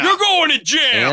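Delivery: very high, loud yelling; shouted threat. Acted. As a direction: yell it like an angry official.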